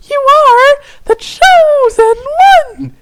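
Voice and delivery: very high, slow, triumphant fairy voice; cheering. Acted. Fairy telling Tavros he is chosen, cheering. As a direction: proclaim it with delight. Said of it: "she cheered".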